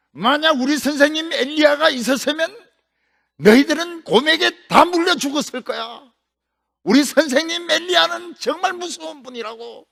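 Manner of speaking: high, heated warning; angry threat. Acted. Elisha angrily warning the thugs about his teacher, heated threat. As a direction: warn them off in a temper.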